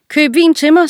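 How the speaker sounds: high, loud bossy king; the king barks an order at his servant; king's order. A narrator's reading. King orders the servant to buy wine, loud and high. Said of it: "sagde kongen".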